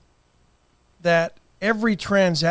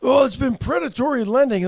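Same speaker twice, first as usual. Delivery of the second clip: mocking quote; 7 semitones higher, 3 dB louder than usual. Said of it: "you know".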